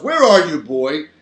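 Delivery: loud call, falling away at the end; shouting. A narrator's reading. Daddy looking for invisible boy, shouting. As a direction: raise your voice for the first words. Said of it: "he shouts".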